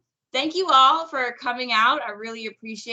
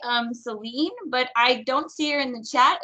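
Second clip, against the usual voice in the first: normal talking voice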